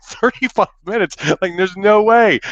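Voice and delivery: retold panicky protest; incredulous outburst. Horrified echo of the duration.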